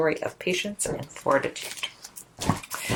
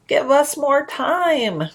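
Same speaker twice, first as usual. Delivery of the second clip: pleading; 8 semitones higher, 4 dB louder, much slower than usual. Acted. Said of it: "Patience pleaded".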